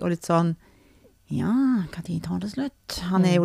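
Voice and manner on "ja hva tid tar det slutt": quietly voiced, waiting-it-out quote; patient son, imitated. Mother imitates son waiting out her crying, subdued. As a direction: murmur it like a son enduring a long hug. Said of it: "William bare står og holder rundt meg".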